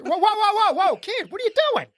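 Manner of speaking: calling out a thief, startled and high. Retold or imitated. Man catches a kid grabbing his change, startled and high. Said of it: "I do a double take".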